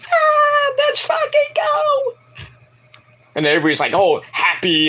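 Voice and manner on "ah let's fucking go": very high slow squealing shout; excited scream. Retold or imitated. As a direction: shriek it like a hyped teen girl. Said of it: "she's like".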